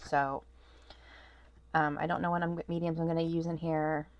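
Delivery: usual speaking voice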